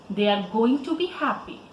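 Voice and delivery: ordinary delivery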